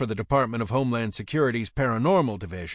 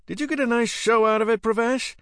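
high, loud incredulous questions; indignant exclamation; 12 semitones higher, 4 dB louder than usual. A narrator's reading. Narrator challenging Pravesh over the show, indignant exclamation. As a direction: exclaim it much higher and louder. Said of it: "I exclaimed".

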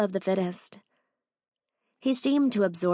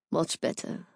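low breathy murmur; hushed, satisfied; 3 semitones lower, 5 dB quieter than usual. A narrator's reading. Owen pleased to have her alone, hushed.

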